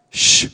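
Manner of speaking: sharp, loud shush. Acted. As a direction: hush someone as an annoyed crowd would. Crowd hushing Bartimaeus, acted out.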